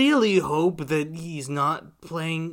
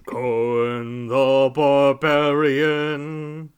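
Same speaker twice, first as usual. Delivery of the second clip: very slow, louder creaky elder; old man voice; 7 dB louder, much slower than usual. Retold or imitated. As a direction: drag it out like an ancient. Mimicking old Cohen.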